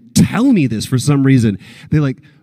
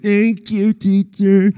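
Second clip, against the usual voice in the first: put-on higher little-kid thank you teacher; childlike thanks; 6 semitones higher, much slower than usual. Grown man thanking the riding teacher, childish voice. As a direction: sound like an obedient schoolkid. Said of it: "I'm like".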